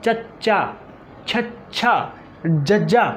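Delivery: ਚੱਚਾ, ਛੱਛਾ, ਜੱਜਾ sounded out separately; tongue front on hard palate for ਚੱਚਾ, ਛੱਛਾ, ਜੱਜਾ; palatal sounds demo. Shown as a demonstration. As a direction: say ਚੱਚਾ, ਛੱਛਾ, ਜੱਜਾ singly, stopping the air before each release. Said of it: "ਤਾਲੂਆ ਹੈ ਉਹਦੇ ਨਾਲ ਲੱਗ ਜਾਂਦਾ ਹੈ".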